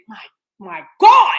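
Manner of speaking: loud, high burst on "God"; awed exclamation. Retold or imitated. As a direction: blurt it out, stunned with pride. Retells her awed exclamation.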